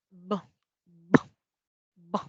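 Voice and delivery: b repeated with a puff of air, lips bursting open; English b sound. Shown as a demonstration. Teacher showing students the English b sound, puffed. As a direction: pop the lips on each b like blowing. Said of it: "como que soplar".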